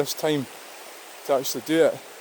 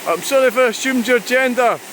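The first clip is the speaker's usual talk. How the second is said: teasing the heron in a high cooing voice; high pet talk; 7 semitones higher than usual. Real, not acted. Talking to the watching heron.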